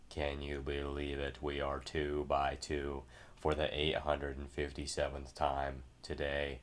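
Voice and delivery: low, slow monotone commentary; deadpan low announcer drone; mock commentary.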